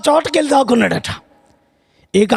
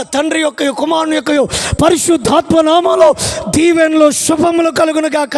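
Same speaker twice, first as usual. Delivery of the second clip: preacher's blessing; 2 semitones higher than usual. Retold or imitated. Pastor's final blessing.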